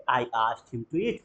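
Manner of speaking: slow and soft, ending on a rise; soft slow drill line; slow repeat-after-me. Shown as a demonstration. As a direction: model it gently for the class. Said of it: "बोलेंगे".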